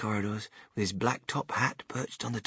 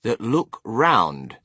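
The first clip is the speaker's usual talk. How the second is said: very slow, drawn-out words, louder; reading out a sign; 3 semitones higher, 5 dB louder, much slower than usual. A narrator's reading. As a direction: announce each word slowly and grandly. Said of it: "it said".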